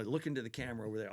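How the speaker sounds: normal talking voice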